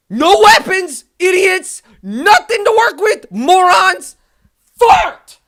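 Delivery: very loud, high-pitched yells; real neighbour-alarming shouting; furious screaming. Real, not acted. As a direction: scream each insult as a separate burst. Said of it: "my neighbor's gonna call the police".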